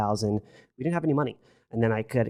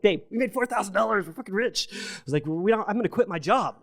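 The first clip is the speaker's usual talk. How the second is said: high, giddy excited announcement, much higher; excited to wife; 8 semitones higher than usual. Retold or imitated. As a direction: burst out with the big news. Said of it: "I'm like".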